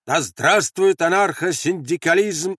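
high, loud, slow rallying cry; shouted slogan. Acted. Men behind the barricade answering, shouting. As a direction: cheer it back ringingly. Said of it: "Закричали в ответ из завала".

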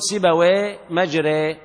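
slow, سيبويه and مجري both ending on e; سيبويه ومجري بنطق ممال بطيء; imala comparison. Shown as a demonstration. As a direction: link سيبويه to مجري slowly, same e vowel. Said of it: "تقول".

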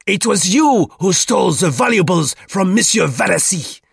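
put-on high, shouted French-accented accusation; furious denunciation. A narrator's reading. Duclos denounces Roux as thief, furiously. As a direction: denounce him grandly at the top of the voice.

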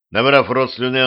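plain reading voice

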